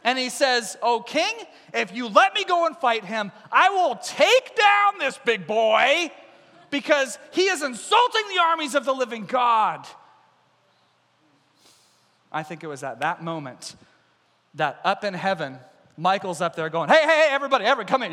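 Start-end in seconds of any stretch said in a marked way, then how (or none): 0.8-9.9 loud, high, rising proclamation; ringing heroic challenge; impassioned vow. Acted. David's bold vow to the king. 16.9-18.1 high, loud summons; eager shout to a crowd; excited calling. Acted. Michael calls the angels over.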